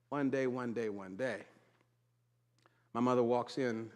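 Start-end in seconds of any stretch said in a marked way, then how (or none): 0.1-1.4 low, soft echo falling away; hushed repetition. Real, not acted. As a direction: bring it down quieter with each repeat. Same words dropped low after build-up.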